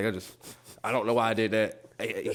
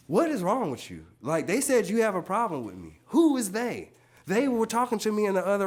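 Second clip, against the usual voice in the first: confrontational; 9 semitones higher, 3 dB louder than usual. Retold or imitated. Acting out the kitchen confrontation.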